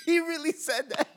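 high, loud, through laughter; laughing disbelief. Real, not acted. Listener cracking up at what he really said, laughing. Laughing at teammate's squad line.

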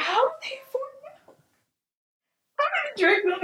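high, teary, hammed-up lament; retold weeping. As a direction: replay the sob over milk. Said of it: "I, like, wept".

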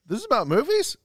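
mocking the question-mark title; rising question. Shown as a demonstration. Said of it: "We have to say it like that every time?".

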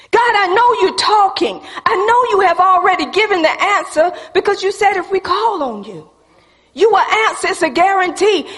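loud-hearted preacher calling out to God, pitched high; fervent preaching. Acted. Preacher pleading with God for answers, fervent preaching.

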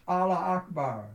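proud cry to the faithful, voice lifted; rallying shout. Acted. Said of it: "will one day shout with us".

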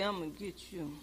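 low, hushed mock threat; very soft, sneaky pet voice; stalking cat voice. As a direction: murmur it like a cat about to pounce. Voices a cat about to pounce.

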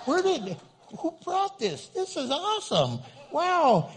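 high, delighted surprise; excited. Retold or imitated. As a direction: get sidetracked by something cool. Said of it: "I'm the guy that'll be talking to you and going".

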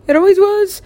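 very high, loud put-on whine; mock whine. Calling hell [name] for them, high mock whine. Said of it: "I'm like".

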